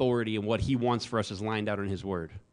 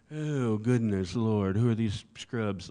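mock posh British accent, slow and sniffy; snooty British voice; slower than usual. Preacher mocking an arrogant Briton looking down, British accent. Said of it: "arrogant people are British in my mind".

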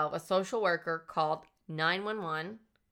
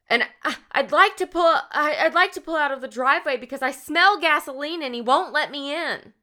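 higher and louder, a panicked mock plea; retelling the frightened caller in a raised, urgent voice; distraught plea; 8 semitones higher, 8 dB louder than usual. Social worker begging the dispatcher for help, imitated panic. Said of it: "she's very, like, distraught".

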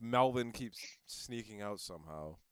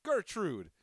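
high, sharp single call of "Gertrude"; sharp scolding call; 9 semitones higher, 3 dB louder than usual. Retold or imitated. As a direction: bark "Gertrude!" once like a warning. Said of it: "The only time I say Gertrude's name normally".

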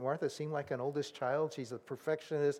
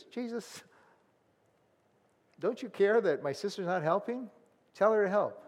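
mildly acted resentful complaint, pitched higher; irritated; 4 semitones higher than usual. Martha appealing to Jesus about Mary, irritated. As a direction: open small and high, then press the complaint. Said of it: "she's irritated".

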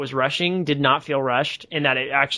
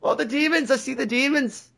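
very high-pitched, comic hysteria; hammed-up visionary in falsetto; mock raving subject; 11 semitones higher than usual. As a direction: wail in a high put-on victim voice. Acts out the raving test subject.

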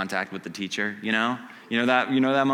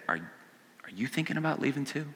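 softer, tender ask; Jesus quietly asking the listener, much softer; gentle question; 9 dB quieter than usual. Acted. Jesus gently asks you.

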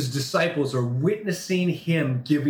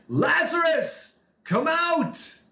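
Lazarus called out high and slow; Jesus calls the dead man out, shouting; commanding shout; 11 semitones higher, much slower than usual. Acted. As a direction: call him from the tomb. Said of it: "Then Jesus shouted".

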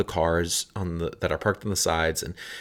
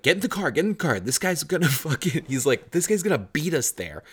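urgent excited; 8 semitones higher, faster than usual. Retold or imitated. Gavin urging, laughing, chase tow.

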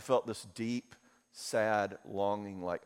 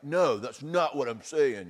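louder, higher, slow insistent protest; cranking up the volume; 4 semitones higher, 7 dB louder, slower than usual. Retold or imitated. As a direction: raise your voice at your wife, slow and pushy. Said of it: "usually I just start upping the volume".

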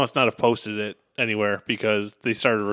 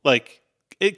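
usual speaking voice

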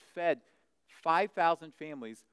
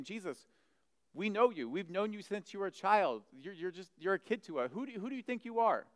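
higher-pitched, scoffing put-down; grumbling disbelief; 7 semitones higher than usual. Retold or imitated. Those who knew Jesus as a child grumble, scoffing. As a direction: act out a dismissive neighbour's challenge. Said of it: "And they grumble against him".

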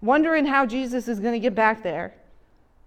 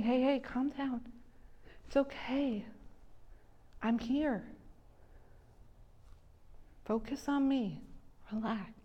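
softer, gentle calming voice; soothing; 12 dB quieter than usual. Retold or imitated. Jesus to terrified disciples, soft and soothing. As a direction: hush a scared friend.

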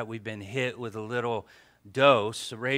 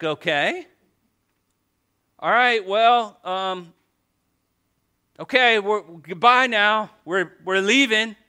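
awkward goodbye; 8 semitones higher, 9 dB louder than usual. Retold or imitated. Leaving the turkey with a parent who ignores him, awkward. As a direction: back out of the room uncomfortably. Awkward exit from ungrateful house.

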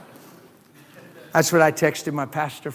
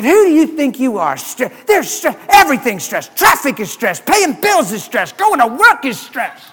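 higher, louder indignant protest; mock indignant; 7 semitones higher, 4 dB louder than usual. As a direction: rattle off the complaints like an offended man. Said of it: "He said".

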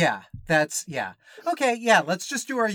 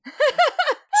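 high laugh; 8 dB louder than usual. Real, not acted. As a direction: burst of laughter. S0 laughs at own bit.

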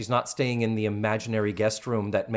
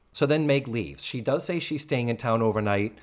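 ordinary talk

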